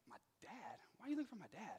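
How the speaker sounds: soft, bewildered, small voice; quiet confused reply. Retold or imitated. Son answering the cop, soft and confused. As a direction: answer meekly, not understanding.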